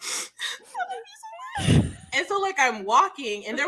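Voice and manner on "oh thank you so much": high, choked-up squeal; squeaky tearful thanks. Retold or imitated. Fan thanking her idol, squeaking through tears. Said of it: "I was like".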